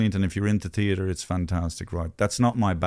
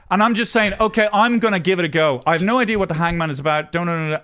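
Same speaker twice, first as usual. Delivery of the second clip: exasperated; 6 semitones higher, 3 dB louder, faster than usual. Real, not acted. Exasperated, talking over Gary.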